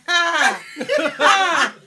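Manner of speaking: loud, high single "ha" bursts; mock laugh. Cohost mimicking the look on her friend's face, loud mock laugh. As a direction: imitate her laughing at you, loudly. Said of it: "She looking like".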